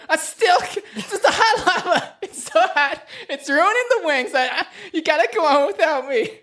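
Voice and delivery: very high granny voice breaking into laughs; laughing old Mildred. Acted. Mildred stuck in lava telling the team to go on, high and giggling. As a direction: bid a dramatic farewell, giggling. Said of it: "She's like".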